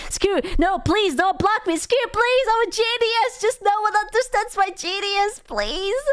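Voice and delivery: high, mock-desperate begging; imitating a pleading pest. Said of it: "he would be like".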